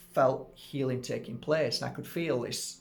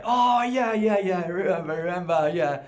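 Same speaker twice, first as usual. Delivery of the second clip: much higher and louder, overeager yeahs; panicking; 3 semitones higher, 5 dB louder than usual. Retold or imitated. Healer pretending to remember the woman, higher, louder and flustered. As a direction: bluff it with flustered enthusiasm. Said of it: "as I am panicking".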